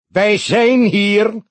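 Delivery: high, loud, very slow pulpit voice; booming solemn address. A narrator's reading. Pastor addressing the funeral, resounding and slow. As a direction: let the words ring through the hall. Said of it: "galmde de stem gedragen verder".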